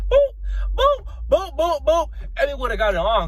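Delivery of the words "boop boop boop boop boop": high, loud cartoon boops; playful boops. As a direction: squeak out a run of punches. Mimes handling Mighty Mouse.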